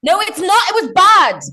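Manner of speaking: high, loud protest. Real, not acted. Comedian insists to host her first show was bad, loud and high.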